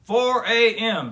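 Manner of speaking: loud, high, drawn-out; jolly grandpa booming the early hour; loud slow announcement. A narrator's reading. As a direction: spell out the hour with relish. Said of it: "said Gramps".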